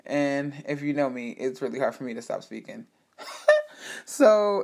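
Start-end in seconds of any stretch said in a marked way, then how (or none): 3.2-4.0 high, loud laugh; laughing at own talkativeness; burst of laughter. Real, not acted. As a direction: laugh at yourself. Laughing at herself.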